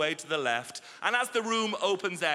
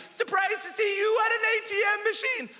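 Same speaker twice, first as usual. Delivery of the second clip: very high-pitched imitated yell; shouted remark. Passing cyclist to comedian at cash machine, high mock shout. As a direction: call out like a passing heckler. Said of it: "he shouted at me".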